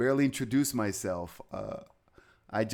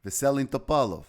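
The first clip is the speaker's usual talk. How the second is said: Russian pronunciation; 4 dB louder than usual. Shown as a demonstration. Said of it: "I could go all day".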